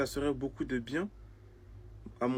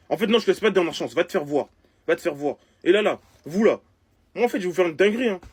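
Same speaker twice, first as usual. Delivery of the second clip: raised, louder indignant voice; angry outburst; 5 semitones higher, 8 dB louder, faster than usual. Acted. Streamer turning on those laughing at him, angry outburst. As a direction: lash out, withdrawing the last chance. Withdraws last chance, acted anger.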